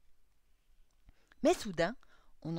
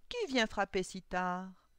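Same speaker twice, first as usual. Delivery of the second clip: put-on slow, loud, wary old man; suspicious Coppelius; 7 dB louder, slower than usual. A narrator's reading. Old clockmaker at a late knock, slow suspicious voice. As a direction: demand it slowly, full of mistrust. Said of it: "marmonna Coppelius se méfiant".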